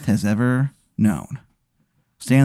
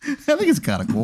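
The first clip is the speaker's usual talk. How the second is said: smug high voice; 11 semitones higher, 4 dB quieter than usual. Acted. GM voices Chet Lightning.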